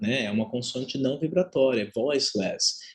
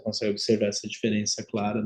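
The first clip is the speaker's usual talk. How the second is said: ordinary talk, nothing marked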